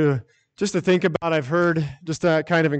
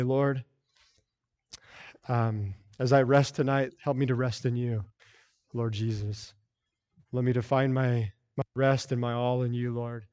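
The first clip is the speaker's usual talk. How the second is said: soft night prayer; 5 semitones lower, 7 dB quieter than usual. Pretend. Believer at bedtime asks the Lord for rest, hushed and soft. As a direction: pray quietly as if about to sleep.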